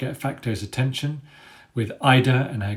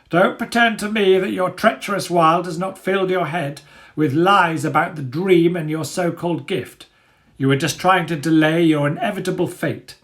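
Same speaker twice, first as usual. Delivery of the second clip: higher, louder scornful accusation; accusing dog leader; 7 semitones higher, 6 dB louder than usual. Acted. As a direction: sneer down at the helpless boy. Dagger accuses the captive boy.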